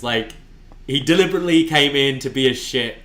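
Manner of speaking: normal talking voice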